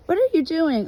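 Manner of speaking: high, loud question to the dog; calling to dog. Real, not acted.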